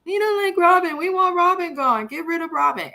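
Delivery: high, whining chant of protest; mock fan complaint. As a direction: imitate a crowd of fed-up fans pleading. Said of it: "fans have been crying".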